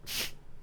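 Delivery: a quick stifled laugh; laughing in disbelief at the absurd scene; short laugh. Real, not acted. Telling the breakup story, a stifled real laugh. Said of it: "I nearly started laughing out loud".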